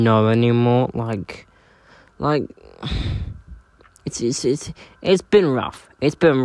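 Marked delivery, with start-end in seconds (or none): 2.8-3.5 breathy tired sigh out; weary sigh. Real, not acted. Weary sigh about enrollments.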